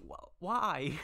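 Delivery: exasperated cry. Real, not acted. Complaining about having to read.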